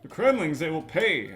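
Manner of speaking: high, loud, hammed-up nineties hero; cheesy nineties cartoon hero. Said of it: "cheesy in inflections they would have in nineties shows".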